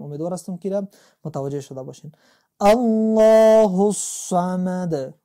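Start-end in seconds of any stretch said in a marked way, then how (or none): 2.6-5.1 الله الصمد with a heavy, full lam; chanted الله الصمد; full Allah. Shown as a demonstration. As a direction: recite slowly and loud, الله heavy.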